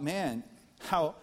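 ordinary talk, nothing marked

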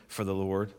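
ordinary talk

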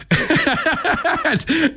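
high-pitched giggling laugh; real laugh. Real, not acted. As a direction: burst out laughing at your own prank. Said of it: "I had a great laugh".

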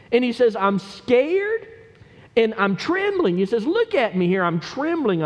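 high, anguished pulpit plea; preacher crying out David's despair in high pitch; impassioned preaching. Acted.

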